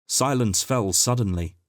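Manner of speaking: ordinary delivery, nothing marked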